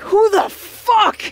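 loud, high, drawn-out snarl. Acted. Dragonborn shaking off snow after the crash, snarling. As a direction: snarl each word out slowly. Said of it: "just snarling".

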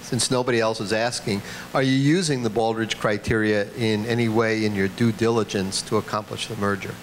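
ordinary talk, nothing marked

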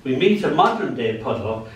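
ordinary talk

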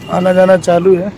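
ordinary talk

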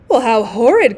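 a loud shocked cry of disgust; cried out. A narrator's reading. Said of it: "cried Missus Otis".

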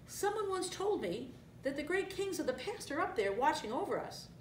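put-on high, unforced young lion voice; musing young lion. A narrator's reading. Young Simba muses on stars.

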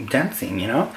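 ordinary talk, nothing marked

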